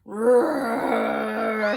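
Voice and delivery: low roaring growl; monster roar. Acted. Butter blob monster roars.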